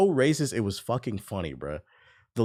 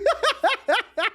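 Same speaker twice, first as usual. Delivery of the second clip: high, rapid cackle; real laugh; 4 dB louder than usual. Real, not acted. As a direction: crack up in high-pitched laughter. Laughing at his own mock insult.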